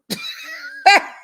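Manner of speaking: high shrieking laugh. Real, not acted. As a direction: burst into a squealing laugh. Narrator reacting to story.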